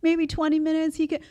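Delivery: mock wheedling in a small, high voice; pleading wife. Mom asks husband to give in for their son, coaxing. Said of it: "And I'm like".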